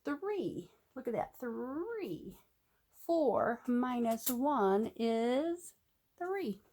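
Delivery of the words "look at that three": usual speaking voice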